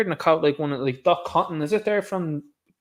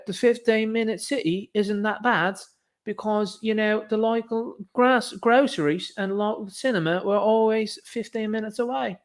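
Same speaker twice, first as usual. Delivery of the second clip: higher, put-on English accent, mocking; 5 semitones higher than usual. Streamer retelling an English Twitter user's post, hammed-up English accent. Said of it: "if that accent was terrible".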